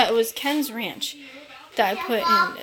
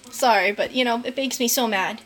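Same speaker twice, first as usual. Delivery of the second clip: ordinary delivery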